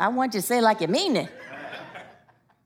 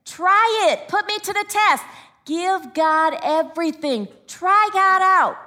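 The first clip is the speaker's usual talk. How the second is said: higher, urging, slowing at the end; urgent preaching; 4 semitones higher, slower than usual. Acted. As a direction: dare them to test God, slow.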